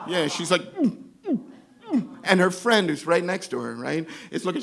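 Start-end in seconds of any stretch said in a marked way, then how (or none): 0.8-2.1 short high stifled retches; muffled mock heaves; imitated dry heaving. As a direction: mimic gagging with the mouth shut. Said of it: "Ashley's dry heaving in the corner".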